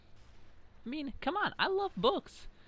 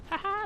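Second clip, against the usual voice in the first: a high, loud triumphant cackle; gloating laugh; 5 dB louder than usual. Real, not acted. Signing off the vlog over his kill cam, gloating laugh. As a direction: crow with a burst of laughter.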